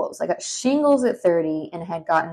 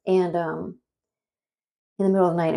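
usual speaking voice

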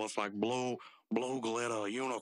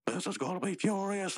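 high-pitched acted anger; livid; 10 semitones higher than usual. Mordecai livid at the unicorns' party, high mock anger. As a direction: play him worked up and higher. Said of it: "Mordecai's just livid".

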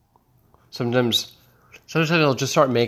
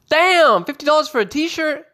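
high loud shocked outburst; 4 dB louder than usual. Acted. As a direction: shriek at the price. Shocked at merch price.

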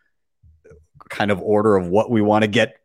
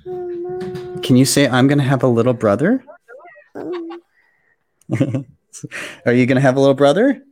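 singsong puppet show host; 7 semitones higher than usual. Real, not acted. Dad coaxing his little daughter.

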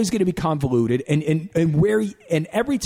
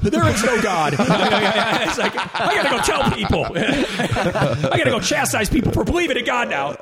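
higher, laughing fake despair; laughing mock despair; 6 semitones higher than usual. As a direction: lament over the sad film while laughing.

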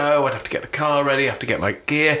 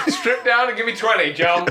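laughing barked order; prison guard voice; 9 semitones higher than usual. Pretend. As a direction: bark it like a guard through laughter. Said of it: "like prison slang".

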